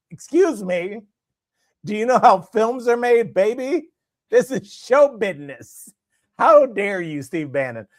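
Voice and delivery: high, loud, huffy mock outrage; hammed-up offended producer; mock indignant showman. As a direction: play a busted cheater bluffing big. Caught husband's indignant bluff.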